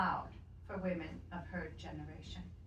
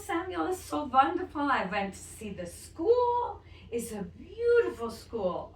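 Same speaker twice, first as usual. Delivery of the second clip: louder, higher grandmother gushing on the phone; a grandma beaming about the school; delighted grandmother; 4 semitones higher, 7 dB louder than usual. Acted.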